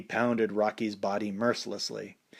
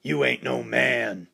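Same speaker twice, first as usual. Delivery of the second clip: taunting; slower than usual. A narrator's reading. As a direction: dare him to come fight. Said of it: "taunted Rocky to meet him".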